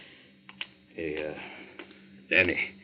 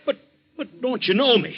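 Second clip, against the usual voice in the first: higher, louder anxious pleading; desperate plea; 14 semitones higher, 5 dB louder than usual. Acted. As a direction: beg him to recognise you. Captain pleads with confused friend.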